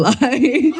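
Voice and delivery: normal talking voice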